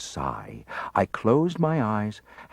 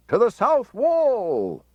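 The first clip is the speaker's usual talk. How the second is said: high, loud, slow battle cry; soldiers' cry; 13 semitones higher, 4 dB louder, slower than usual. A narrator's reading. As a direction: shout it as a rallying cry.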